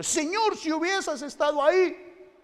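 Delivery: very high, loud, slow wailing reproach; Martha's lament. Retold or imitated. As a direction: cry it out like grieving Martha. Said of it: "como una Marta, diciéndole".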